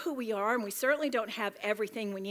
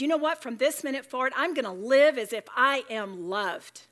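loud declaration; 3 semitones higher, 7 dB louder than usual. Shown as a demonstration. Declaring a new decision.

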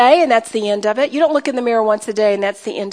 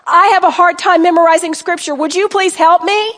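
modelled prayer; 4 semitones higher, 4 dB louder than usual. Shown as a demonstration. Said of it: "stop talking like that and say".

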